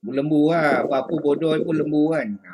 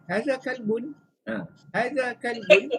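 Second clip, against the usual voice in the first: "Haza kalbun?" pitched much higher, rising at the end; high question tune; 7 semitones higher than usual. Shown as a demonstration. Teacher shows a question without the A. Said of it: "kita boleh buat tanya".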